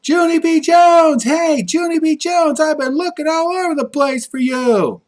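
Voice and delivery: high, loud calling out a name; high hollered call for a friend; hollering. A narrator's reading. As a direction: call across the playground, high and bright. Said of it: "heard a voice holler at me".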